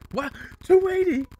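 piercingly high, loud disbelief; mock shock. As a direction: squawk it in exaggerated astonishment. Shocked at the price.

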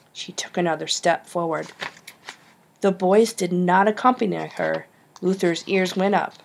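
plain reading voice

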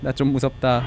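monotone android admitting fear; robot voice. Pretend. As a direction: plead for help in an emotionless robot monotone. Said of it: "난 기계다".

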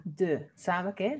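ordinary talk

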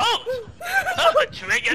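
laughing yelp. Real, not acted. Surprised by a trap.

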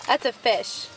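ordinary talk, nothing marked

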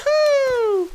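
high, loud whoop of joy; excited cheer. Real, not acted. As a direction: let out a big happy whoop. Cheering in game.

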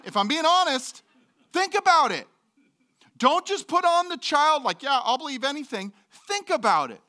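high, loud urgent plea; urgent preaching. Real, not acted. Pastor urging the congregation, high and loud. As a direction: press the congregation hard.

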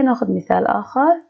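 ordinary delivery

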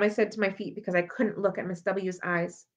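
ordinary delivery

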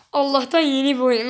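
ordinary talk

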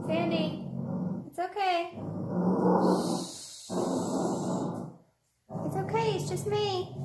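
high, loud calming voice to a pet; soothing the dog. Real, not acted. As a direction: hush a frightened dog, sweetly. Calming her startled dog.

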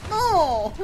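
sudden high, loud no; frustrated cry. Real, not acted. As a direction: yelp it at the screen. Failing the squeak slip.

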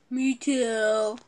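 low, quiet character voice. Acted. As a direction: drop the pitch right down. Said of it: "Why's your voice deeper?".

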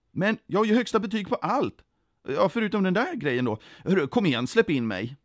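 pleading applicant. A narrator's reading. Sökande med högsta betyg vill in, insistent. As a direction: argue your way in.

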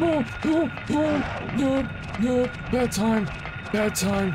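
very high panicked yelps; panicked squeal. Real, not acted. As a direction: squeal in sudden fright. Monster attacks at bad moment.

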